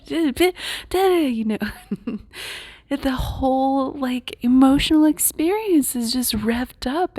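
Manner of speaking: high, squeaky hammed-up freak-out; imitating her own frightened self, overblown; mock panic. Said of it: "feeling like".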